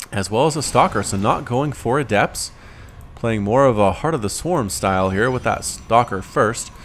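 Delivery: ordinary delivery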